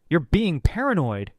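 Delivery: tense outburst, pitch swooping up; tight, defensive. A narrator's reading. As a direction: exclaim it in exasperation. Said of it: "he rasped, voice tight".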